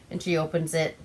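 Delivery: normal talking voice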